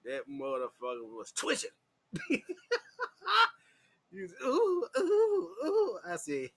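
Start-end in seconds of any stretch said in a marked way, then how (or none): 4.4-6.0 high, louder falsetto "ooh" moans; mock moaning. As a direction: ham up his twitchy moaning. Imitating the twitching guy.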